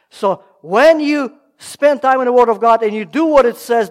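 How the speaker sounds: loud, driving sermon delivery; preaching at full force. Acted. Preacher urging the congregation to act on God's Word, loud and driving. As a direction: press the point home to the congregation.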